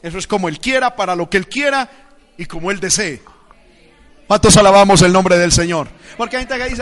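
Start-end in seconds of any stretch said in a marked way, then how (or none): 4.3-5.9 booming call to praise; rallying shout. Real, not acted. Preacher asks the church who praises the Lord, rallying shout. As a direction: shout it out to the whole congregation.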